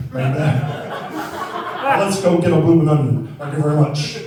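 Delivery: put-on slow, loud [name] drawl with an Australian accent. Comic riffing as Australian [name], slow loud Australian [name] drawl. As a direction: stretch it out like [name] stuck in Australia. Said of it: "impression of Australian [name]".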